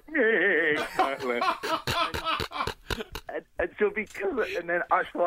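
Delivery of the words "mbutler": drawn-out "nyeh" before "butler"; comic catchphrase groan, breaking into laughter; Blakey. Retold or imitated. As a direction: mimic a sitcom busman's stretched noise. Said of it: "He's still gonna have to go".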